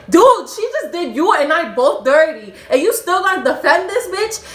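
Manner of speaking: high, loud acted-out argument; outraged re-enacted shouting; indignant yelling. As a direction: demand loudly why he takes her side. Acted quarrel with friend.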